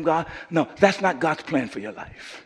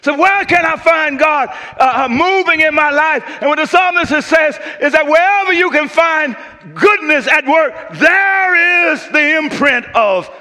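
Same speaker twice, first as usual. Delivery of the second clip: rising, louder preacher's build; climbing sermon cadence; preaching at full force; 12 semitones higher, 5 dB louder than usual. Acted. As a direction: drive it home to the congregation.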